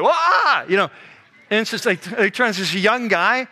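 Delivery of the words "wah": high, loud mock shriek of fright; startled yelp. Women in a dark tomb startled by a voice, yelping in mock fright. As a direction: jump out of your skin with a yelp. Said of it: "They're like".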